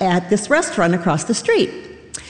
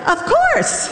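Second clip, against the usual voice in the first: eager yes; 13 semitones higher than usual. Retold or imitated. Eager reply to a job offer.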